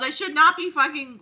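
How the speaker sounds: ordinary talk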